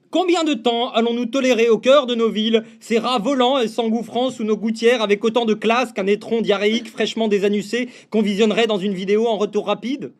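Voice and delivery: ordinary delivery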